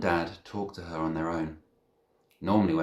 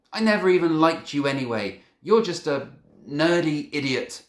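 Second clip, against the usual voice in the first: angry yell; 10 semitones higher, 9 dB louder than usual. A narrator's reading. Hurt boy yells insults.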